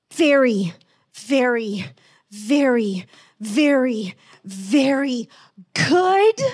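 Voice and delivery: Jesus saying very good with emphasis; emphatic very good. Retold or imitated. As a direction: build each very to a big good. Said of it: "emphatically going".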